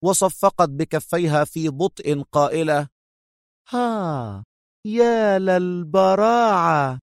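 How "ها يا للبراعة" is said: slow, high, drawn-out mock admiration; feigned astonishment. A narrator's reading. Captive woman slowly applauding her captor, mock amazed. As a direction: draw it out as if hugely impressed. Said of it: "في دهشة مصطنعة".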